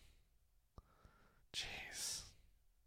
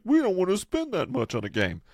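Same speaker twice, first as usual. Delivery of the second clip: higher, lightly put-on whine of a stingy executive; mock studio exec; 11 semitones higher than usual. Mocking big studios' complaint, higher put-on whine. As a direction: ham up a cheap studio boss.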